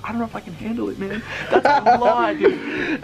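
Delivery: giggly secret whisper in a toilet stall; hushed stall whisper. Retold or imitated. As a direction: whisper it so nobody outside hears. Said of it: "All he hears is".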